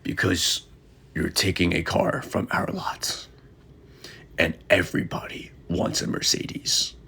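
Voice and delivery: whispered, low warning; secretive warning. Retold or imitated. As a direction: hush it like a secret tip. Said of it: "And they're like".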